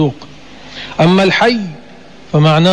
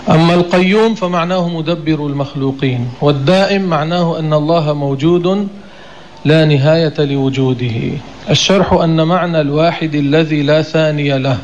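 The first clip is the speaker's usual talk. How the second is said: usual speaking voice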